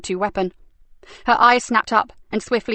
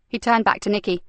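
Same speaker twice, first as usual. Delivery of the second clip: plain reading voice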